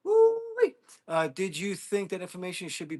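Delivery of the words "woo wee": high, loud gleeful woo-wee; excited woo-wee. Real, not acted. Streamer hyped at chat, high loud woo-wee. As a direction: whoop it out with glee.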